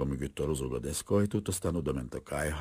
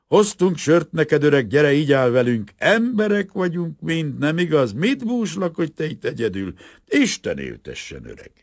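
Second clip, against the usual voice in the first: put-on drunken cheer; 12 semitones higher, 9 dB louder than usual. Drunk workers toasting the old man.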